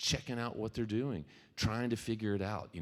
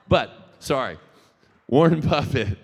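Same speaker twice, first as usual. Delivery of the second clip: much louder and higher, snapping back on track; loud correction; 7 semitones higher, 13 dB louder than usual. Real, not acted. Speaker loudly returning to the Warren Buffett quote. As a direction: announce the name like a headline.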